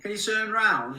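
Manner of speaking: high, loud, slow mock request; put-on request. Last of the acted requests.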